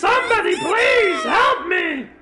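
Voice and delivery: high, loud sobbing plea; crying for help. Acted. Naomi begging anyone to help her, high crying shout. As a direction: beg for help through tears, high and loud. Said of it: "she cries on her vomit".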